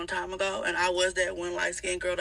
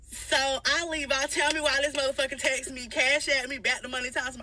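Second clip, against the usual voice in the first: ordinary delivery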